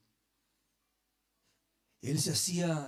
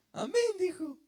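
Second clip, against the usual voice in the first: squeaky high mock exclamation, much higher; 13 semitones higher than usual. Preacher mimics a Maipú member, squeaky high mock amen. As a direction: squeal the amen like a caricatured churchgoer.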